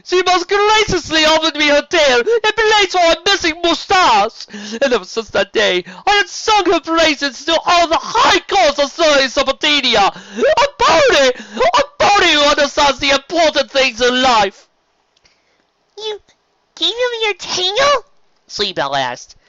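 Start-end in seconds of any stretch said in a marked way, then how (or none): 0.0-14.7 much higher, louder theatrical duke voice; over-the-top friendly serpent duke; flamboyant sea serpent. Acted. Serpent sings praises. 16.0-18.0 much higher, slow squeaky filly gasp; little filly squeaking in surprise. Acted. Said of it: "her voice squeaking in surprise".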